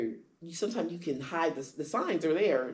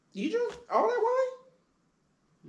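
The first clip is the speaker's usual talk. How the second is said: much higher, very slow, drawn out; shocked disbelief; 3 dB louder, much slower than usual. Retold or imitated. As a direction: stretch out the shock at each word. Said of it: "come back and be like".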